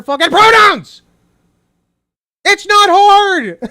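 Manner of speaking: loud, high, slow angry yell; angry shout. Real, not acted. Streamer yelling at the woman in the clip, high and slow. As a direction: yell the obvious at the screen, slow.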